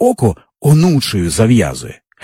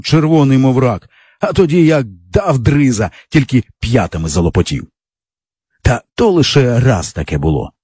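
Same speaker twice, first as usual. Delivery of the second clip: ordinary delivery, nothing marked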